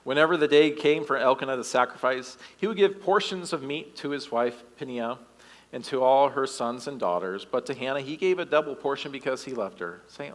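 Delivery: usual speaking voice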